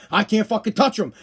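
ordinary talk, nothing marked

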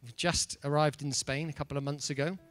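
usual speaking voice

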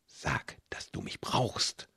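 soft whisper into his ear; murmured. A narrator's reading. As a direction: breathe it right into his ear. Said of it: "raunte er mir ins Ohr".

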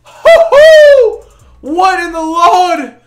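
very high, loud, drawn-out whooping; ecstatic shouting. Real, not acted. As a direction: whoop and yell in stunned delight. Stunned by crossover play.

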